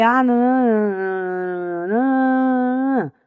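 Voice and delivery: droning wordless mumble, vowels dragged out; mock a bored reader, stretching each sound very slowly; unwilling student droning through a text; reluctant reading. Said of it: "já pega o texto de má vontade".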